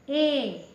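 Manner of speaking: example vowel ए, short and light; demo sound ए. Shown as a demonstration. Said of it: "जैसे".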